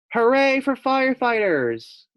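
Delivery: put-on high, loud kid cheer. Maxwell cheers the firefighters in a high loud shout. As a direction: shout hooray like an excited child. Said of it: "said Maxwell".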